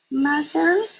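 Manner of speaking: put-on high, small squeak of a lost baby; squeaking baby bat. Lost baby bat calls for her mother, high squeak. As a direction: call out like a tiny frightened bat. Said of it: "Stellaluna squeaked".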